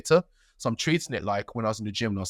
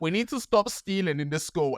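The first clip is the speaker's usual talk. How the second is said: high, stern school assembly voice; head teacher speech; 7 semitones higher than usual. Retold or imitated. Head teacher addressing the assembly about one thief, stern. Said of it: "the head teacher has to do a speech".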